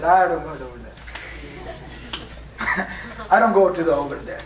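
low, slow, muffled mock uncle; imitating an uncle. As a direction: drag it out like the disapproving uncle, a hint of laughter. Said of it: "Everyone's got an uncle that talks like this".

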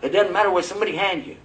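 high and loud with laughter; hammed-up delight. Someone breaking fast on a stale cracker, overjoyed. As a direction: rave about a stale cracker. Acting hungry praise of cracker.

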